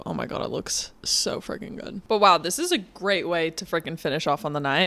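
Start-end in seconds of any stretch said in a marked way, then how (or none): 0.0-2.0 breathless whisper of awe. Real, not acted. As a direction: whisper it in delight. Admiring the ice cream close up.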